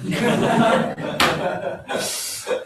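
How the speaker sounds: airy laugh, falling off; a quick airy chuckle at your own joke; breathy laugh. Real, not acted.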